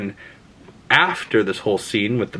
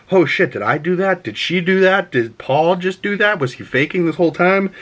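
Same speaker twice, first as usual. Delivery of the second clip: startled disbelief; 7 semitones higher than usual. Retold or imitated. Voices Chani's startled thoughts.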